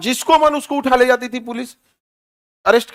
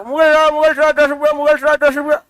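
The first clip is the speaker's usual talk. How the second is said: auto driver calling; 5 semitones higher than usual. Retold or imitated. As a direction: call out fares like an auto driver. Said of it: "पहले मुठ-, बोल". (ऑटो वाले की ऊँची पुकार)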